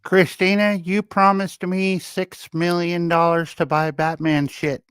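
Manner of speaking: high, slow mocking whine at Christina; hammed-up high whiny voice; mock streamer. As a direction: parody the streamer demanding his money.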